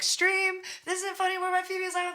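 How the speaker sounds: high-pitched mock yell; imitated yelling. Artist imitating friends pushing her song on a crowd, yelling. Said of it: "They were, like, yelling".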